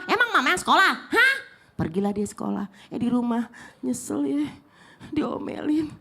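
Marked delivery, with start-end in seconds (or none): 0.1-1.3 sarcastic mother. Retold or imitated.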